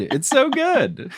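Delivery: a hammed-up, drawn-out imitation of McGonagall; mock McGonagall echo. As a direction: echo the praise as a posh Scottish professor. Host echoing the accent playfully.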